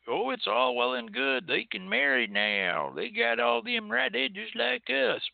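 high sing-song mockery of a naive ally; mock complacent voice. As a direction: parody someone who thinks it's all fixed. Mocking a complacent listener's view.

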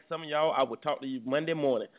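usual speaking voice

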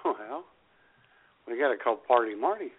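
ordinary delivery

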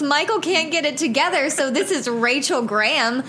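bright upbeat host greeting; podcast host intro. Shown as a demonstration. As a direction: sell the show opener cheerfully. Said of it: "this is how the episode starts".

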